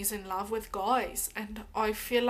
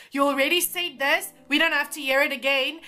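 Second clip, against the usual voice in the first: louder, fast, fed-up retort; exasperated; 4 semitones higher, 8 dB louder than usual. Real, not acted. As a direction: snap back at the repeater, irritated. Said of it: "annoyed at the repeated comment".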